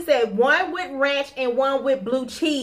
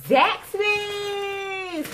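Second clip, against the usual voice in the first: excited; 8 semitones higher than usual. Real, not acted. Announcing the restaurant name.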